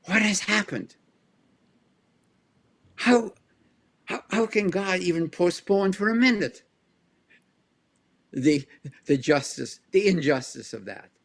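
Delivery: a voiced apostle's rising indignation; Paul's outraged plea. Quoting Paul on the execution, lightly acted indignant plea. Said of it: "says Paul".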